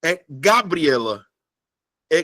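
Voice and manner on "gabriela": "Gabriela" slowly, wrongly stressed GA-briela. Shown as a demonstration. Teacher offers students a wrong option, stress on GA. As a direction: hit the first syllable of "Gabriela". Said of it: "qual é a sílaba que eu falo mais forte".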